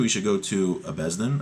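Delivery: ordinary talk, nothing marked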